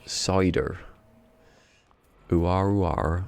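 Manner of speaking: lightly put-on Somerset yokel; a touch of rustic Somerset burr; yokel Somerset accent. Shown as a demonstration. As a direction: do a West Country cider drinker. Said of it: "do a good yokel Somerset accent".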